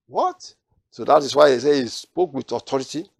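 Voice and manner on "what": sharply high, rising what; astonished what. Retold or imitated. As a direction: blurt it in disbelief. Astonished listeners' reaction.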